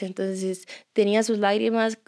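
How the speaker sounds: ordinary talk, nothing marked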